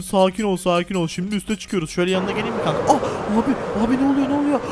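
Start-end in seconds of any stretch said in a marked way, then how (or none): 2.9-4.6 high, panicky exclamation; alarmed. Pretend. Rider to friend as the tower climbs, alarmed. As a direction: cry out as if being launched upward. Ride shoots them upward.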